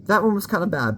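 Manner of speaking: ordinary talk, nothing marked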